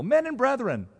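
high, loud appeal to the council; crying out. Acted. Paul crying out to the divided council, high and loud. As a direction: call it out over the crowd. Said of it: "he cried out in the council".

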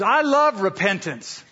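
imitating a gleeful friend, high and drawn out; slow, high-pitched mock delight; happy declaration. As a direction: declare it with cheery relish. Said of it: "he's all happy".

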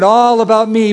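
high, insistent mock demand; mock self-centred pleading. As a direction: whine it like a needy believer. Mimics self-centred believer.

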